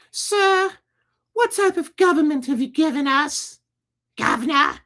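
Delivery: very high falsetto British woman; comic British falsetto lady voice; British townswoman. Acted. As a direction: squeak it out in a hammed-up British accent. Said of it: "Why did she become British".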